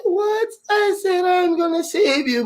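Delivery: very high, slow squeak; high squeaky voice. Retold or imitated. Telemarketer lady and the savior squeaking, high and slow. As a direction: squeak it out in a falsetto.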